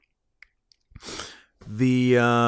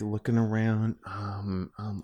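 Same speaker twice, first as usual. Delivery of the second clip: quiet stalling; 6 semitones lower, 6 dB quieter than usual. Pretend. Pretending to look around, stalling.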